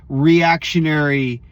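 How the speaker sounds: usual speaking voice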